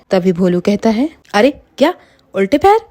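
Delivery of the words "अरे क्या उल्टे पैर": high-pitched storybook alarm; acted shock at a horrible discovery. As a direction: blurt it out in startled disbelief, high. Kidnapper sees the backwards feet.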